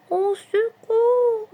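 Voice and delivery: very high, drawn-out whimper for help; moaning. A narrator's reading. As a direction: wail slowly as a frightened little rabbit. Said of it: "gémit Lapin Coquin". (gémissement aigu et traînant)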